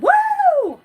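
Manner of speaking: high, loud woo; excited whoop. Real, not acted. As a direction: let out a big happy woo. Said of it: "I'm just like".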